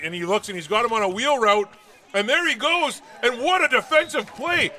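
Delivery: excited. Real, not acted. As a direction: call the big play at full thrill. Live call of a big play.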